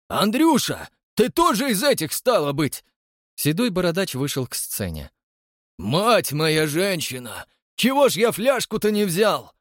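put-on high, rustic old man's voice; old bearded man. A narrator's reading. Bearded old man to Andrey, amazed. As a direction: gasp like an amazed old villager.

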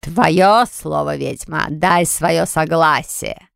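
slow, commanding demonic call; commanding dark spirit. Acted. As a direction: demand it slowly and darkly. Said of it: "крикнула Эмпуза".

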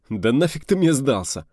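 annoyed retort; grumbling. Acted. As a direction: dismiss him crossly. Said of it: "проворчал Кобрин".

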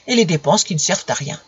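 plain reading voice

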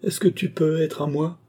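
high, urgent self-reproach; anguished cry. A narrator's reading. Woman breaking away from the marquis, crying out. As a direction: cry out against the dream. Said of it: "s'écria-t-elle".

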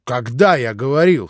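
helpless bewildered stammer; lost, babbling. A narrator's reading. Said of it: "совсем потерянно пролепетал Иван".

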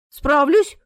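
punchy self-assured comeback; confident. A narrator's reading. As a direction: answer firmly, sure of yourself. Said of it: "уверенно ответила Марина".

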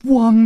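high, loud playful woof; dog bark. Acted. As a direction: bark like a puppy to tease. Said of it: "的一声叫".

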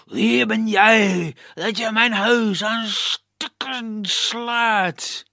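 loud, slow booming forest spirit. Acted. Forest spirit booms at the prince wrecking its home. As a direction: boom down at the intruder. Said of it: "met bulderende stem".

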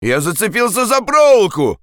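high-pitched cry for patience; calling out. Acted. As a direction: call up while stuck. Andres calls up, caught in wire. (тревожный крик)

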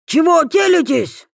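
shrieking high indignant yell; shrill indignant shout. A narrator's reading. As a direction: squeal it out in outrage. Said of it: "даже взвизгнул от возмущения".

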